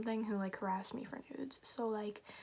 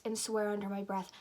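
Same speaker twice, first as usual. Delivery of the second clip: ordinary delivery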